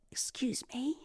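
put-on a shy girl's hushed request; timid whisper. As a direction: whisper it shyly, barely daring to speak. Timid girl approaches stranger.

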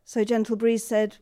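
ordinary delivery, nothing marked

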